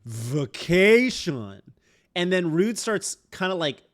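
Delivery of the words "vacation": VACATION stretched long with a showy V; imitating a pretentious "vacation", slow and emphatic; drawn-out vacation. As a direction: draw out "vacation", louder, leaning on the V. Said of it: "she's like".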